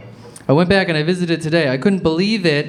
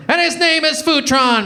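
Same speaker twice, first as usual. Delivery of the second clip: hammed punchline; 11 semitones higher than usual. Punchline in gunslinger duel.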